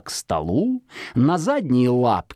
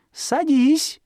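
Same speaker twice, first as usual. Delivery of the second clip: high sweet fox command; 12 semitones higher than usual. A narrator's reading. Fox to Zhikharka at the oven, high coaxing command. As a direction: coax him onto the shovel. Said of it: "говорит Жихарке".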